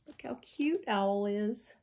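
ordinary delivery, nothing marked

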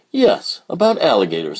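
put-on little girl voice; squeaky young child; high child voice. Child character voice.

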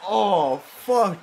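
ordinary talk, nothing marked

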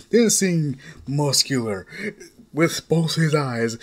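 plain reading voice

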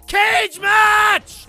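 high, loud wrestling-style call; hyped shout. Real, not acted. Imagining a wrestling brawl, a hyped shout. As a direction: call it like a ring announcer.

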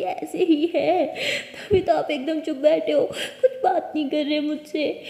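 slow, tearful laugh while complaining; laughing through tears. A narrator's reading. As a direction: sulk and laugh at once. अनोखी रोते-हँसते शिकायत. (रोते-हँसते शिकायत करती)

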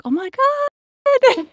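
a long, high, amazed Oh my God; thrilled gasp. Retold or imitated. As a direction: squeal it out in slow delight. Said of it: "I was like".

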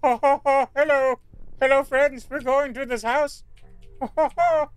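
high, loud hammed-up ho-ho-ho greeting; silly put-on voice. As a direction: greet friends in a high, goofy voice.

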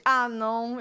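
normal talking voice

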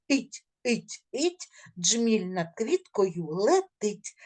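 every sound articulated clearly and slowly; careful pronunciation. Shown as a demonstration. Teacher shows pupils how to say each sound, careful and slow. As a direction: spell out each syllable for the kids. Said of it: "Гарненько вимовляйте всі звуки".

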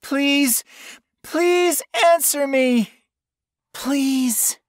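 very high, frightened begging; tearful pleading. A narrator's reading. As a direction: beg desperately for an answer. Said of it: "sobbed, peering down the hallway through frightened tears".

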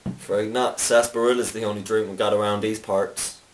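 friendly American Southern bartender voice, lightly put on; mock Southern accent. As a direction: greet a stranger like an old-time saloon keeper in a Southern accent. Cowboy bartender role.

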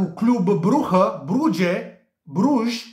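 "Brugge" as "Brukhe", then "Brudje", then "Bruj" as "broozh"; alternative pronunciations. Shown as a demonstration. Tipster lets viewers choose, saying "Brugge" three different ways. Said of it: "cum, uh, vă place vouă să pronunțați".

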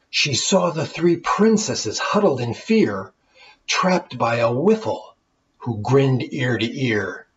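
lively rhyming storybook reading; story narration. A narrator's reading. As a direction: read it like a bedtime tale for kids. Reads the rhyming tale aloud.

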